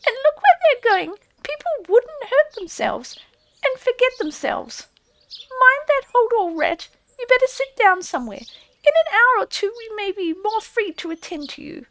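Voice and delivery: high, prim squeaky reproach; stiff field mouse. A narrator's reading. Field mouse primly scolding the fallen Rat, stiff and squeaky. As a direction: sniff at him in a tiny prim voice. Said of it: "said a field mouse rather stiffly".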